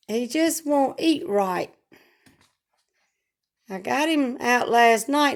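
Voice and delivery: ordinary delivery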